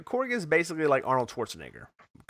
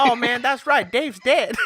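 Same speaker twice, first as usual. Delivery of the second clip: high, louder mock-casual realization; Korg; 10 semitones higher, 6 dB louder, slower than usual. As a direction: imitate a laid-back character suddenly remembering, high and loud. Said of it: "He's like".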